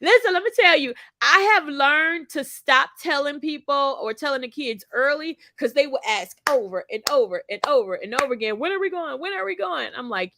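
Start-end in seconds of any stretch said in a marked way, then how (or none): 0.0-4.0 excited. Real, not acted. Excited launch into story. 8.6-9.9 put-on whiny child. Kids nagging about trip.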